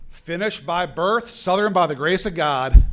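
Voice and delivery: put-on Finnish accent putting on a loud American Southern drawl; a Finnish president hamming up Southern, loud and proud; Finnish man doing Southern accent. Finnish president boasting of his Southern college years, Finnish accent faking Southern. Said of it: "in a Finnish accent, doing an American Southern accent".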